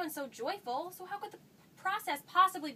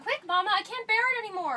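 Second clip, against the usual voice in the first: loud and higher, at the end of her patience; desperate plea; 4 semitones higher, 8 dB louder than usual. Acted. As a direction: beg her to hurry, bursting. Daughter desperate for the answer.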